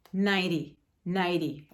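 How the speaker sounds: ninety with a quick flapped t, like naiɾi; spoken form. Shown as a demonstration. Teacher showing how ninety really sounds in speech. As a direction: say ninety fast, the t almost a d. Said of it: "mas você fala".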